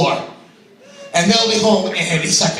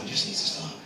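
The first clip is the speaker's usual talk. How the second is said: much softer, breathed under the voice; whispered aside; 12 dB quieter, faster than usual. Real, not acted. Comedian repeating himself to audience, whispered aside. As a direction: drop to a whisper as if to yourself.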